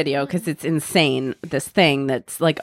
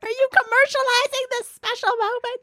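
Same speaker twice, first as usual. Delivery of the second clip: mock outraged bride. Mocking a bride who gets a camera proposal, high and indignant. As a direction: squeal it like a scandalized bride.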